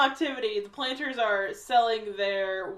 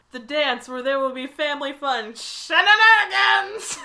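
announcer voice; 5 semitones higher, 9 dB louder than usual. Retold or imitated. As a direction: boom it like a PA announcer. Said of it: "we hear a announcement".

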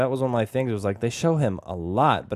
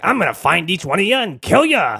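creepy clown voice; 8 semitones higher, 6 dB louder than usual. Retold or imitated. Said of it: "he's like".

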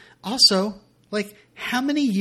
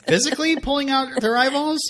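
high, louder disbelieving question; incredulous; 12 semitones higher, 5 dB louder than usual. Real, not acted. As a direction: ask it in shocked disbelief. Incredulous question about eyeballs.

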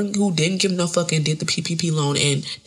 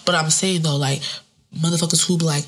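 ordinary talk, nothing marked

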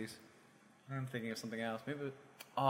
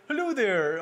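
put-on Canadian accent; 8 semitones higher, 6 dB louder, slower than usual. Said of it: "I can't do a Canadian accent".

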